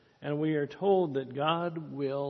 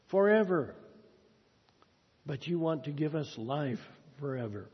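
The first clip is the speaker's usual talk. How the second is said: prayer. Acted. Pastor praying for the congregation after the sermon, earnest prayer. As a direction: pray humbly with pauses for the congregation.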